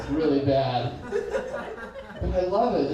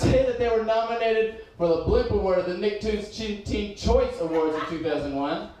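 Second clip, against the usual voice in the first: usual speaking voice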